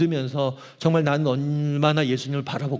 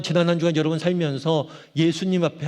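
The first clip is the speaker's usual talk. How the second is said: ordinary delivery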